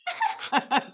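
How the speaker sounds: sudden high squeal of a laugh; high burst of laughter. Real, not acted. Laughs at own frozen face.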